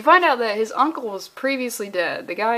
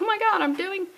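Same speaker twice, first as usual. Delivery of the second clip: high-pitched mock gushing mother; hammed-up delight in a higher voice; super excited mom; 8 semitones higher than usual. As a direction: gush like a thrilled mom, pitched way up. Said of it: "the mom's super excited".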